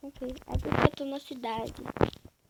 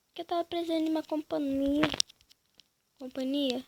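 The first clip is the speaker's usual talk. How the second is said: usual speaking voice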